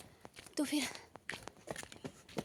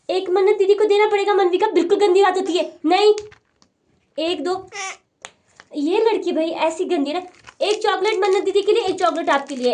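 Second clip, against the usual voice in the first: scolding; 5 semitones higher, 8 dB louder than usual. Real, not acted. Scolding daughter to share.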